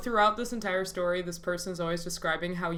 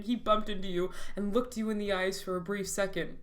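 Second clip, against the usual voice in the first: ordinary delivery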